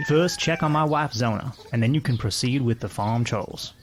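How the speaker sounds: farmer instructing in West Virginia speech; West Virginia accent. Acted. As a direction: give the orders in a put-on West Virginia accent. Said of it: "trying to do a West Virginia accent".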